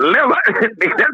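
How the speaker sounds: high, rising stunned echo; stunned by the amount; incredulous. Real, not acted. Disbelief at the price.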